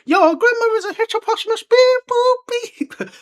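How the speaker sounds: very high squeaky puppet voice; Potter Puppet Pals voice. Retold or imitated. Quoting Potter Puppet Pals, high puppet squeak. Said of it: "Potter Puppet Pal swear words".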